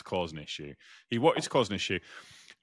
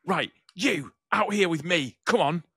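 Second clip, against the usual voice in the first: high-pitched, raised mock command; retold angry challenge; 9 semitones higher, 4 dB louder than usual. Angry man ordering me outside to fight, retold challenge. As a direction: imitate a man demanding a fight, word by word.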